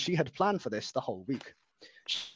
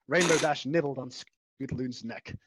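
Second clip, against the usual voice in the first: plain reading voice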